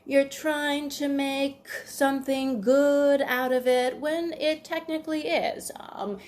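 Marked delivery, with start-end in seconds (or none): 0.1-4.0 higher, slow, hesitant excuse; fumbling parent. Retold or imitated. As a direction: stretch the excuse out slowly, up high. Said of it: "they might say something like".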